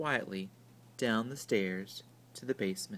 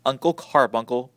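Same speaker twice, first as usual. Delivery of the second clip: protested; 8 dB louder than usual. A narrator's reading. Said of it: "protested Alice Jane".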